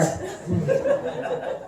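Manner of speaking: airy giggle up high; high breathy laugh. Real, not acted. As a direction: laugh it off lightly at your own line. Laughs at own joke.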